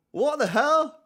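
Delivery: high, loud exclamation of shock. Real, not acted. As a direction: blurt it out in disbelief. Shocked by Laporte's voice.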